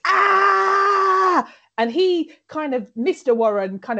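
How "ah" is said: high, loud hammed-up shriek; a hammed-up fit scream; mock seizure scream. Conchita's seizure fit, loud high mock scream. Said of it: "seizure screaming fit".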